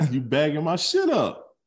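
high, slow aggrieved complaint; mock yelling. Son confronting father over packed belongings, mock yelling. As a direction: play the son hollering in protest. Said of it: "you yelling at me in my house".

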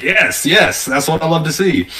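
high, loud, delighted "yes, yes"; gleeful excitement. Retold or imitated. DM delighted by the player's stunned reaction, gleeful. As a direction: relish the player's shock, thrilled. Player says "Bro, this is insane.

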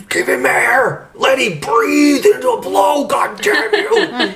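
hammed-up high-pitched scream; mock frantic scream, drawn out. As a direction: imitate a desperate rescuer screaming, far above your voice. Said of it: "Brian is screaming".

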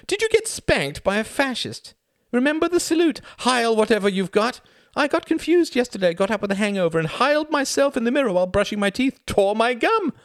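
very high, loud, fast-tumbling chatter; tipsy gossipy Greta. Acted. Greta rattles off her hangover story to Klaus, very high and loud. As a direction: babble a silly party story. Narrator voices Greta at length.

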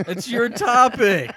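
high, loud, drawn-out laughing exclamation; laughing protest. Real, not acted. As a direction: protest slowly and loudly through laughter. Laughing protest over forgotten topic.

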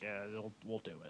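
soft, low, reluctant mumble; half-hearted agreement. Retold or imitated. Actors only here for the money, half-hearted agreement. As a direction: trail it out very quietly, barely committing.

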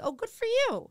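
very high, polite gushing; mock encouragement. Comic humouring a date who wants to act, mock encouragement. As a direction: coo it with feigned enthusiasm. Retold reply to would-be actor.

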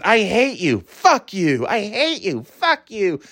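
high-pitched back-and-forth squabble; mock screaming match. Mother and daughter fight back and forth, mock angry. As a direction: trade insults in a hammed-up high voice. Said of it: "they just go back and forth".

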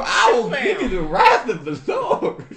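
loud, slow hammed-up threat; mock preacher mom. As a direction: threaten with fire and brimstone. Mom parody continues.

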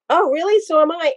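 high, delighted surprise; bright mock-excited "Oh, really?"; surprised.

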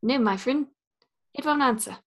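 put-on light New Zealand Korg accent; relaxed Korg storytelling, New Zealand accent. Friend guessing game, easygoing Korg in a New Zealand accent. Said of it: "trying to be Korg from Thor Ragnarok".

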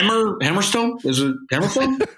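lightly mocking a hesitant co-host; teasing imitation of unsure questioning; mock second-guessing. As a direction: mimic him fumbling a name as a question. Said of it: "And you're like".

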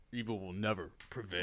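deep, low and quiet hero catchphrase; cool hero voice. Pretend. Hero answering the robbers, low cool voice. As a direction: drop the voice low for a cool superhero line. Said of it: "you have the most coolest voice".

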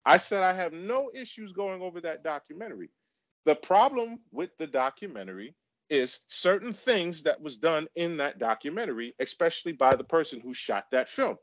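ordinary delivery

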